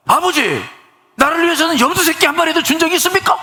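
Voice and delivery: high-pitched indignant outburst at father; elder son yelling at his father about the feast; acted shouting. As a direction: hurl the grievance at him. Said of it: "막 소리 지르는 거죠".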